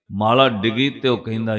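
normal talking voice

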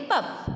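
"पप" with a short open a, not पुप; "पप", open a sound. Shown as a demonstration. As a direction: say "पप" like pup. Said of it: "पुप नहीं होके हम इसे बोलेंगे".

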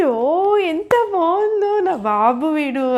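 high, slow, delighted sigh of pride; delighted admiration. Acted. Toymaker admiring his puppet, high and delighted. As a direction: marvel at your new baby boy.

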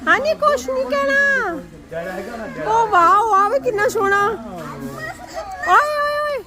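high, bright-eyed coaxing sing-song. Real, not acted. Mother soothing toddler afraid of the cooker, high coaxing.

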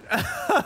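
very high burst of laughter; high giggle. Real, not acted. Streamer cracking up at San Pedro appearing. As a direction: crack up helplessly.

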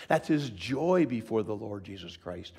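ordinary delivery

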